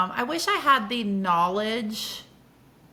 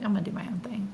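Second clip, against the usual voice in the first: hushed, unvoiced kid defiance; whispered defiance; faster than usual. Retold childish defiance.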